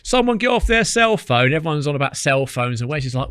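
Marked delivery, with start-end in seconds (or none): 0.0-1.5 high, loud call down the bank; shouting down. Retold or imitated. Ringer shouting down from the bank, loud and high. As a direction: yell it down at the angler below, slowly. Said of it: "shouting down".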